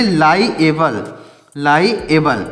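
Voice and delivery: "लाइएबल" slowly, as lai and then e-bal, low and falling; prononciation modèle. Shown as a demonstration. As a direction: split "लाइएबल" into drawn-out parts for learners. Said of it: "इसको क्या पढ़ेंगे?".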